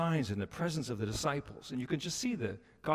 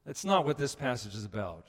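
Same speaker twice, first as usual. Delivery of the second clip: normal talking voice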